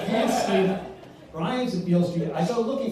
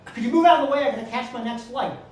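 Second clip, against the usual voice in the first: loud, higher-pitched impatient yell; 7 semitones higher, 7 dB louder, faster than usual. Retold or imitated. Impatient young passenger to people blocking the aisle, yelling. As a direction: yell it out over a crowd. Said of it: "he yells out".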